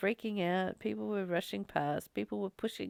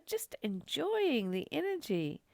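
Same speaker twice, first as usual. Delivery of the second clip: usual speaking voice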